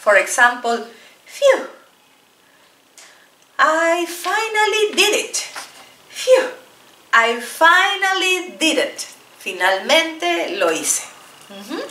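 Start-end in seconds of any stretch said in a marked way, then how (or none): 1.3-5.4 high sigh, then slow relieved line; teacher acting relief at last. Shown as a demonstration. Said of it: "Para expresar alivio, relief". 6.1-9.1 high sigh, then slow relieved line; teacher acting relief at last. Shown as a demonstration. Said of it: "Para expresar alivio".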